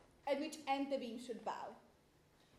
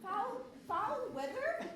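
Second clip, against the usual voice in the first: higher, slow, scoffing repeat; mocking echo; 7 semitones higher than usual. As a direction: drag the words out in scorn.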